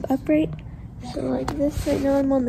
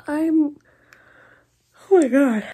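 usual speaking voice

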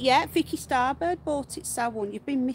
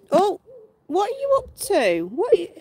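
higher cooing pet voice; 8 semitones higher, 5 dB louder than usual. Real, not acted. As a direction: tease the pet with a high coo.

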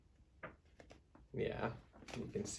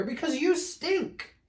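very high-pitched taunting exclamation; storybook shout; 6 dB louder, slower than usual. A narrator's reading. Fluffy bear telling smelly bear off, high-pitched shout. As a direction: land the insult in a high, raised voice. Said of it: "shouted Big Fluffy Bear".

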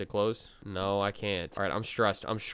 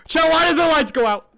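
scared shriek; 11 dB louder than usual. Real, not acted. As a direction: shriek it in real fright. Lights go out in horror game.

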